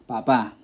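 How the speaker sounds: Papá stressed on its final syllable, "pa-PA"; Papá with end stress; stress on the last. Shown as a demonstration. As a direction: land on the second syllable of Papá. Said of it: "the pronunciation is on the last".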